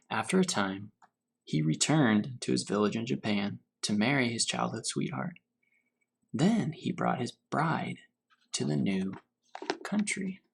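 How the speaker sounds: unhurried storybook reading to children, lightly voiced; story reading. A narrator's reading. Teacher reading Grandfather's Journey to her class, gentle storytelling. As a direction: read it gently, letting each image settle. Teacher reads picture book aloud.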